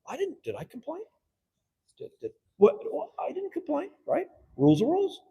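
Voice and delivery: high, loud mock protest from the rival; mock-innocent rival. Rival council member protests he never complained, mock-innocent and high.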